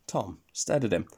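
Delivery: ordinary delivery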